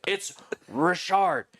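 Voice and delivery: "Richard" as ree-SHARD, high, slow and forceful; mother correcting, stretch "Richard" with stress on SHARD; indignant French correction. Retold or imitated. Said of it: "she was like a fucking Nazi".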